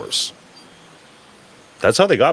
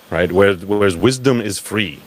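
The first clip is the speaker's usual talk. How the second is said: ordinary talk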